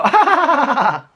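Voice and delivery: high, loud mocking cackle; fake laugh. Replying when Alex asks what's wrong, mock laugh. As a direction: answer with an exaggerated fake laugh. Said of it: "like, not fake".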